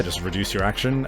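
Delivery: usual speaking voice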